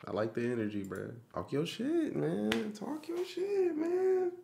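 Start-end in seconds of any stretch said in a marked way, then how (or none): 1.4-4.3 hyped up. Real, not acted. Hyped reacting to the verse.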